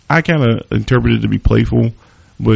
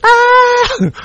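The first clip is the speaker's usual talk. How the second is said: sudden, very high shriek of shock; high-pitched squeal; 4 dB louder than usual. Retold or imitated. Man slapped by his date squeals in alarm. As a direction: let out a tiny panicked squeal. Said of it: "I heard a high-pitched squeal".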